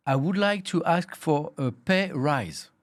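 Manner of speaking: English in a strong French accent, slowly spelled out; unashamed French-accented English. Shown as a demonstration. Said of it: "L'accent est catastrophique".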